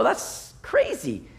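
high, slow, quiet disbelief; that's crazy, said in stunned disbelief; incredulous. Retold or imitated. Someone hearing the saw-the-baby plan, incredulous. Listener reacting to absurd plan.

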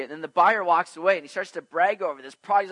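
ordinary talk, nothing marked

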